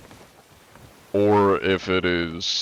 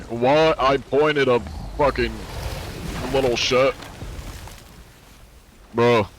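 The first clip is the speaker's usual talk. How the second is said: indignant outburst; 4 semitones higher than usual. Real, not acted. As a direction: shout it in disbelief. Outburst after being attacked.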